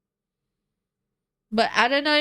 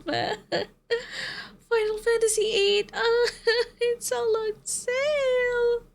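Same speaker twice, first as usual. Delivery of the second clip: high, laughing whine, close to tears; mock despair; 6 semitones higher than usual. Real, not acted. Gamer lamenting that it's all on sale, mock-crying. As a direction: wail it through helpless laughter.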